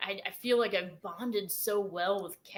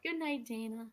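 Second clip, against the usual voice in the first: put-on soft, raised little-girl voice; precious baby-doll voice; 4 semitones higher, 6 dB quieter than usual. Recalling a ghost child on the recording, precious baby-doll voice. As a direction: imitate a sweet little girl, softly. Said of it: "the most precious voice, baby doll".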